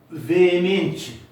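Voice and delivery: veemente lifted and loud, ve and e split; loud example word. Shown as a demonstration. Teacher to class, pronouncing veemente loudly. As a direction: sound veemente so both Es are heard. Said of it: "dois Es, um ao lado do outro".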